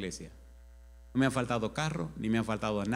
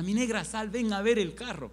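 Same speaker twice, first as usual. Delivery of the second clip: excited call; 9 semitones higher than usual. Retold or imitated. Calling wife to see car.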